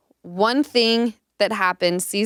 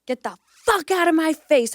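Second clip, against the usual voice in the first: high-pitched re-enacted outburst; mock-furious, pitched up but not shouted; losing it; 8 semitones higher than usual. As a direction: act out telling someone off. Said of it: "I was losing it".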